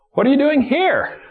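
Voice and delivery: HERE thrown high, then falling; stress on HERE. Shown as a demonstration. Preacher asks God's question of Elijah, stress on HERE. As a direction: rise hard onto the word "here". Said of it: "emphasize a different word".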